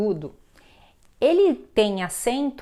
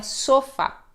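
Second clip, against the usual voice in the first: "sofá" mis-stressed as SO-fa; stress on first syllable; 4 semitones higher than usual. Shown as a demonstration. Teacher showing the unaccented reading, "sofá" stressed on SO. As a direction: put the weight on SO in "sofá". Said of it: "damos ênfase na segunda sílaba".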